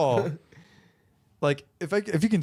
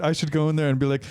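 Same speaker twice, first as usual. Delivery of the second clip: ordinary talk, nothing marked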